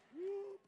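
high whooped yell imitating Aggies; a quick whoop like a Texas A&M fan.

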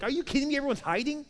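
high, incredulous outburst; mock-outraged challenge; indignant anger. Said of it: "And David is angry".

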